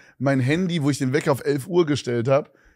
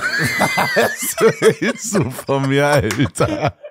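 lachend; much slower than usual. Real, not acted. Lachender Ausbruch.

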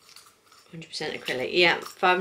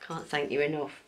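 ordinary talk

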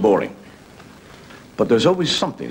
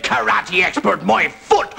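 "Karate expert" said slowly and scornfully, higher, then "my foot"; sceptical mockery; 8 semitones higher, much slower than usual. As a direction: scoff at the claim, dragging "Karate expert" out. Brother mocks the claim.

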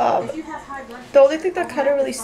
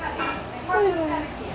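high cooing pet voice; 7 semitones higher, much slower than usual. Real, not acted. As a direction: coo at the pet.

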